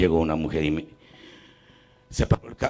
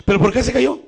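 high, loud, pushing question; insist, pitch way up; insistent demand; 12 semitones higher, 4 dB louder, faster than usual. Retold or imitated. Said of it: "le digo".

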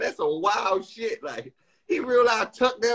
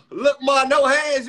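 mock shout; 8 semitones higher, 7 dB louder, slower than usual. Mocking a famous catchphrase.

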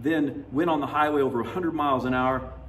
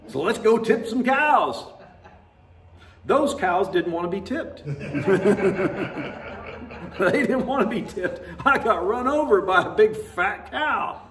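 higher and louder, breaking into laughter; giggling and louder as he tells of tipping cows; laughing story; 9 semitones higher, 6 dB louder than usual. Real, not acted. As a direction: laugh your way through the memory, higher and louder. Laughing through a cow-tipping story.

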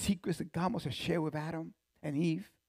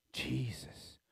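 whispered answer; 4 semitones lower, 10 dB quieter than usual. Acted. Answering his own question in hush.

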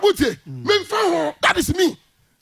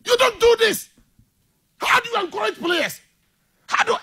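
indignant scolding; 6 semitones higher than usual. Real, not acted. Scolding the bench behaviour.

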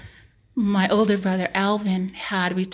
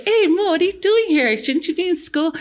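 much higher, louder put-on customer voice; nosy customer; 10 semitones higher, 4 dB louder than usual. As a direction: play a prying store customer teasing him. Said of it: "someone would come to the store and say".

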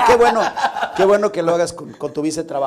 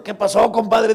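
worried compadre; 6 semitones higher, 9 dB louder, slower than usual. Acted. Joke, compadre asks after blow.

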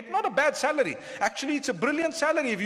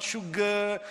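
"sugar" in a Malay accent; 3 dB quieter than usual. Shown as a demonstration. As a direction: say "sugar" the Malay way, with a drawn-out ending. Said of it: "I said sugar in a Malay accent".

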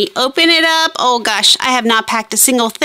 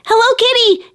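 higher, bright run-together Hello Kitty; realizing; 8 semitones higher than usual. Shown as a demonstration. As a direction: it clicks: say Hello Kitty as one name. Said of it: "I remember I said".

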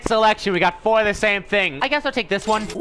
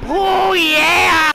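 high, loud drawn-out cheer; whooping victory yell; triumphant shout; 9 semitones higher, 6 dB louder than usual. Real, not acted. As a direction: celebrate the win at full voice. Cheering after kill.

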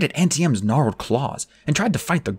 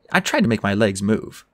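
plain reading voice

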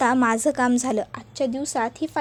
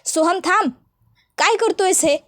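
raised voice, pitched higher, calling him to stop; shouting at Soham; 6 semitones higher than usual. Acted. Kajal yells at Soham to stop the fight, raised voice. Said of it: "जोरात ओरडते".

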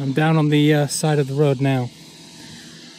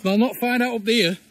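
imitating a thick Castleford accent, pitched up; higher-voiced mimicry of a Castleford local; 7 semitones higher than usual. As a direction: quote him in broad Castleford. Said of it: "in his thick Castleford accent".

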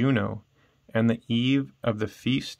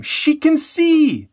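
much higher and louder, slow overjoyed cry; joyful exclamation; 6 dB louder, slower than usual. Acted. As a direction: shout the miracle out in delight. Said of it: "Marcus exclaimed".

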